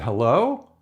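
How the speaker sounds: low, hesitant call into the dark; a careful, unsure hello; tentative hello. Retold or imitated. Scout peeking into a strange shack, tentative. Knocking at a creepy open door.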